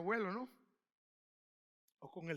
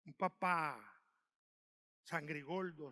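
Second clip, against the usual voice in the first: lower, grumbling grumpy dad; a mock grouchy father; 7 semitones lower, 6 dB quieter, slower than usual. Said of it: "gruñón". (papá gruñón, voz más grave)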